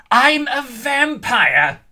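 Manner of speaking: high, loud, slow vampire scream; the vampire reveals herself with a high, loud scream. A narrator's reading. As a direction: shriek it out, stretching each word. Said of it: "she screamed".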